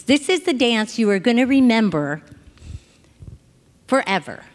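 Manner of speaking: ordinary delivery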